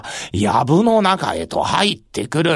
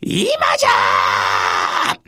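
slow shouted signal to charge; battle cry; 12 semitones lower, much slower than usual. Acted. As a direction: bellow the attack order. Signal to attack. (突撃の掛け声)

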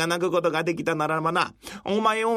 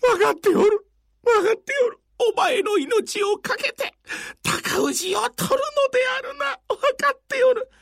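crying shout; 4 dB louder than usual. Acted. Old man keeps weeping loudly.

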